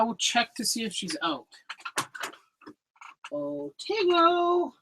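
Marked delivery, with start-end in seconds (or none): 3.3-4.7 high, drawn-out soft call. Real, not acted. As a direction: coax a pet out with a slow singsong call. Calling the hidden animal out.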